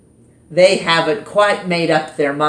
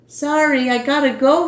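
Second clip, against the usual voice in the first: Justin in a hurry; 9 semitones higher, faster than usual. Acted. Justin leaves Brian.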